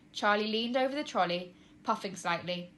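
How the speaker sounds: ordinary delivery, nothing marked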